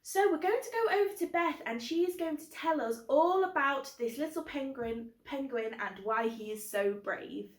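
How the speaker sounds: ordinary delivery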